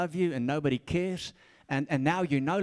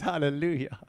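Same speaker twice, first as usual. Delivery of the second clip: ordinary talk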